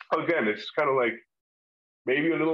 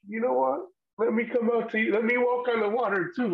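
eager, high-pitched plea; eager Peter; 8 semitones higher than usual. Retold or imitated. Peter begging Jesus to let him join, eager. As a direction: pipe up keenly, much higher than usual.

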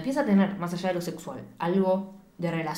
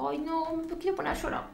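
tender mock whimper; 8 semitones higher, 6 dB quieter than usual. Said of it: "la revisa como".

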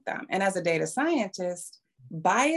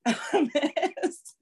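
higher, laughing through the words; 6 semitones higher, slower than usual. Real, not acted. Data scientist joking about glass phone design, laughing. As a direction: talk through incredulous laughter.

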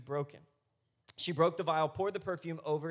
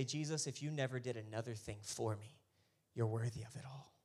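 soft, low, prayerful voice; lowered, tender worship; hushed devotion; 4 semitones lower, 6 dB quieter than usual. Acted. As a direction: speak it quietly to Jesus in reverence. Soft prayer voiced over music.

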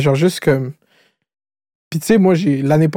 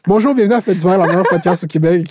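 podcast host intro; 3 semitones higher than usual. Acted.

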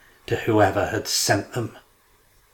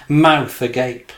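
plain reading voice

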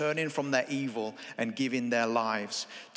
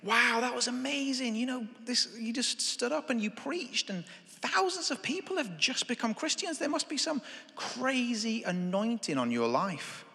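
much higher, breathless awe; awed praise; 8 semitones higher than usual. Pretend. To the preacher afterwards, much higher awed praise. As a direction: gush over his anointing. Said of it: "and you say to him".

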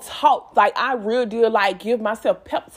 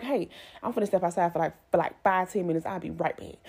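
much softer and lower, slipping out quietly; quiet excuse; 5 semitones lower, 8 dB quieter, faster than usual. Retold or imitated. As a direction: murmur it so nobody notices you're upset. Quietly excusing herself from coworkers.

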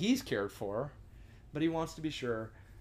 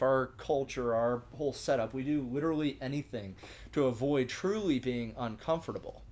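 ordinary delivery

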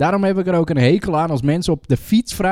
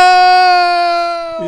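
high, loud sustained mock scream; long high-pitched prank shriek; 5 dB louder than usual. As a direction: scream suddenly to startle passers-by. Demonstrates startling shout from car.

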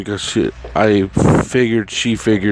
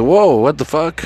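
high-pitched, startled disbelief; taken aback; 7 semitones higher than usual. Retold or imitated. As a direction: jump up in pitch, stunned. Retelling his shock at her questions.

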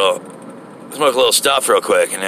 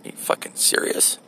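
incredulous whisper; 8 dB quieter than usual. Retold or imitated. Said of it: "looking at him like".

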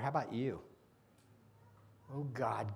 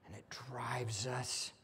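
soft, whispered, slowing down; hushed preaching; 3 semitones lower, 12 dB quieter than usual. Real, not acted. Preacher quietly telling the congregation where love leads. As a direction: sink to a whisper.

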